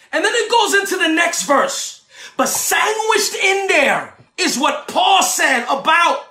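rising, shouted sermon cadence with long held words; preaching at full force. Acted. Preacher preaching judgment of angels to his listeners, shouting. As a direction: preach it to the congregation, building to shouts.

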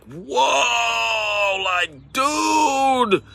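long drawn-out, high-pitched mock shock; retold stunned whoa. Pastor reacting to a friend cursing on the phone, mock shock. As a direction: stretch out a high, taken-aback protest.

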